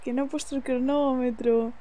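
normal talking voice

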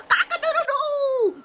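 high, drawn-out rooster crow with a laugh. Shown as a demonstration. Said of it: "You think I can sound like a bird?".

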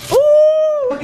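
sudden loud high ooh. Real, not acted. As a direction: let out a loud rising ooh. Sudden exclamation.

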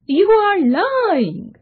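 high, slow, drawn-out denial; stretched-out, high-pitched indignation; indignant accusation. A narrator's reading. John angrily denies the voice's accusation.

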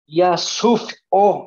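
"suf o" split, F closing the first part; mock mispronunciation. Shown as a demonstration. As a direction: break "suf o" in two, slowly. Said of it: "así dirías".

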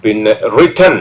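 ordinary talk

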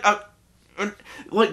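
normal talking voice

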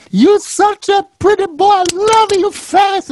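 high, loud doting Italian granny; grandmother voice. Retold or imitated. Grandma cooing over her grandson, high doting granny voice. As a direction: squeal it like a doting old grandma pinching cheeks. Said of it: "She'd go".

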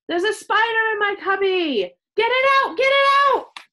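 very high, loud shrieking; panicked shout. A narrator's reading. Chloe up on a chair, shouting in panic. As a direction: shriek for someone to save you. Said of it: "Chloe shouted".

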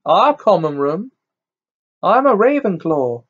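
put-on huffy Ravenclaw girl; stiff girl. A narrator's reading. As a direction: correct them with prim indignation. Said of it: "said the girl stiffly".

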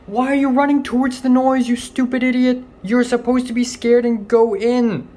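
high loud scolding yell; exasperated yelling. Real, not acted. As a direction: yell at it in panic. Yelling at cockroach.

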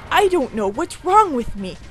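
ordinary talk, nothing marked

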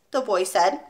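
ordinary delivery

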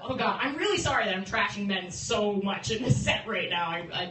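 ordinary talk, nothing marked

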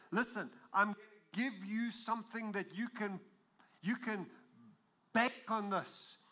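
fiery preacher voice; full-force preaching. Acted. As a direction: proclaim it to the whole congregation. Preaching Leon's translation.

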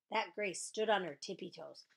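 ordinary delivery

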